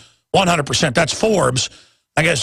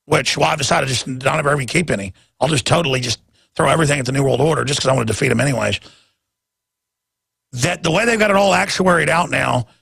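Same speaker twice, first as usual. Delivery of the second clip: normal talking voice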